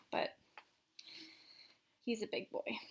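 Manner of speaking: usual speaking voice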